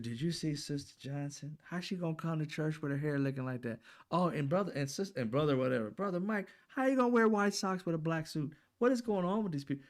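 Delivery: high, catty gossip voice, mocking; gossiping church lady. Churchgoers gossiping about Sister Johnson outside church, catty high mock. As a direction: imitate petty churchgoers trashing each other's looks.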